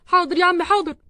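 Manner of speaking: loud, hasty compliance; flustered shout. Acted. Husband answering father-in-law while rushing, flustered. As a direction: call back loudly in a rush.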